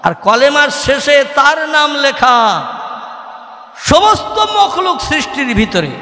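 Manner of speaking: high sing-song list; full-force preaching. Acted. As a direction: build the praise phrase by phrase. (চড়া সুরে জোরালো ওয়াজ)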